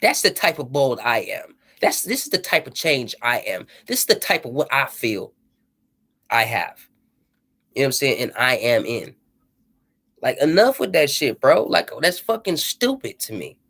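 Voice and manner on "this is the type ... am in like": ordinary talk, nothing marked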